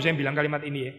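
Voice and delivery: usual speaking voice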